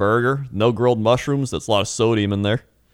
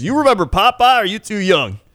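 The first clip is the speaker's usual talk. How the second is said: put-on old trucker; 11 semitones higher, 5 dB louder than usual. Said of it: "he goes".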